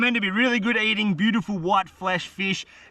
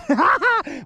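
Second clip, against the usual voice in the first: delighted laugh; 11 semitones higher than usual. Real, not acted. Laughing at the hooked fish.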